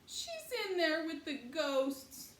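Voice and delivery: high, loud, slow tearful holler; crying child hollering; hollering in tears. A narrator's reading. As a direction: howl out her fear for the dog. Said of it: "hollering herself blue".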